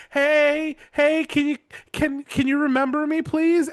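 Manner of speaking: high, eager plea for attention; mock pleading. Captain's daughter to passing lover, mock pleading. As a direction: call out high and needy, hammed up.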